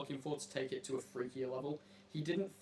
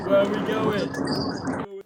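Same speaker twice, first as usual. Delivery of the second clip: drawn-out call; 5 semitones higher, 13 dB louder, much slower than usual. Acted. Host stretching the question to rile up the crowd, slow drawn call. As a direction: stretch every word out slowly.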